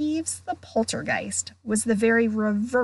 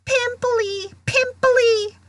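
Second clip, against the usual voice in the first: high, jeering repeated shout; yelling taunt; 13 semitones higher, 4 dB louder, slower than usual. A narrator's reading. Poltergeist pelting fleeing ghost with peanuts, yelling. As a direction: chase her with a piercing name-call.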